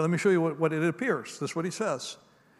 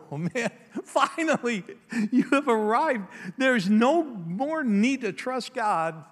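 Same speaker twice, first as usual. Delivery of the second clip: mock relief; 6 semitones higher, slower than usual. Mocking listener's relief.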